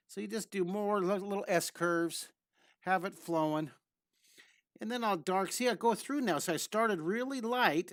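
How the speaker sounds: ordinary delivery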